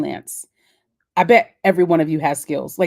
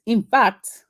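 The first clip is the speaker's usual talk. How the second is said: higher, put-on Nigerian lilt on "in fact"; Nigerian accent; 5 semitones higher, 4 dB louder than usual. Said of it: "my fake Nigerian accent".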